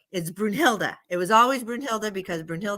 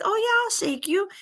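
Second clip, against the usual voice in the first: high, eager helper voice; eager little girl; 11 semitones higher than usual. Retold or imitated. Little girl agrees to help the crying tree, eager. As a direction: pitch it up, sweetly willing.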